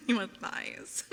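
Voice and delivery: loud words between giggles; laughing. Real, not acted. Streamer to viewer asking about thighs, laughing. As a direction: ask it while cracking up.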